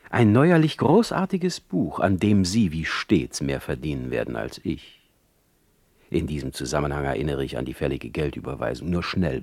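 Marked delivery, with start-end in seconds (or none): 0.1-2.0 higher, louder proud boast; grand self-praise; boastful. Acted. 6.1-9.4 low, fast slipped-in reminder; quick sheepish mention; hurried aside. Acted. Quick aside about money.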